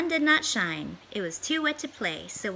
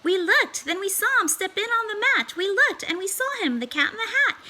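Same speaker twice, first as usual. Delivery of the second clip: higher and louder, thrilled reveal; excited storytelling; 10 semitones higher, 4 dB louder than usual. A narrator's reading. Narrator to children, thrilled at the cat's arrival. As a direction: gasp it out like a child seeing the cat.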